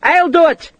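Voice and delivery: Scottish captain volunteering. A narrator's reading. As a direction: take charge, call it out. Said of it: "the Scotsman said".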